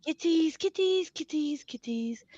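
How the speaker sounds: cooing kitties. Real, not acted. Cooing at the cats on camera.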